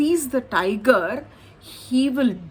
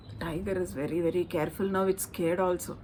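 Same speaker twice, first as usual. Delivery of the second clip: much softer, low and tense; hushed narration; 4 semitones lower, 8 dB quieter, much faster than usual. A narrator's reading. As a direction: drop to a hush for the scared tiger.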